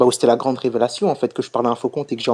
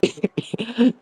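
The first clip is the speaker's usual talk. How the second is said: high giggle; real chuckle; 10 semitones higher, 4 dB quieter than usual. Real, not acted. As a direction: giggle in the middle of telling it. Laughing during his own anecdote.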